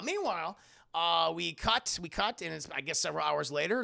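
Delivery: usual speaking voice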